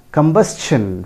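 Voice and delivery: careful S-CH in combustion; correct pronunciation. Shown as a demonstration. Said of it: "It is pronounced as". (combustion का सही उच्चारण)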